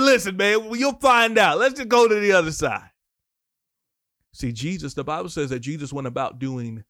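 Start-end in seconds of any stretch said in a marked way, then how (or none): 0.0-2.9 high, loud, insistent reply; playacted exasperated leader; mock impatient brush-off. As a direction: brush off the question and move them along. Voices Jesus brushing off the question.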